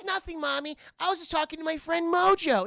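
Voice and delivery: put-on high, innocent little girl; a kid covering for her secret friend, pitched high. Streamer voicing the girl answering her mom, high and innocent. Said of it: "she goes".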